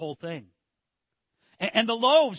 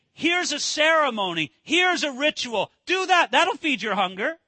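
raised, loud, lightly acted hard sell; mock religionist pitch; 6 semitones higher, 4 dB louder than usual. Preacher mimicking religionists offering rituals to the hungry, insistent. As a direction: push it like an eager peddler of rituals.

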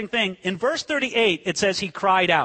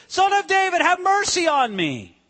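acted screaming out; 9 semitones higher, 3 dB louder than usual. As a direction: cry it high, then stretch the plea. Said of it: "starts screaming out to Jesus".

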